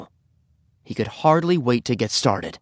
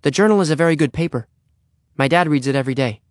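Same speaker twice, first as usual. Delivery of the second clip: quick, higher-pitched sales plug; hastily; 5 semitones higher, faster than usual. Acted. Henry rescues his newspaper sale, hasty and higher. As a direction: rush it out to recover. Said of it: "he added hastily".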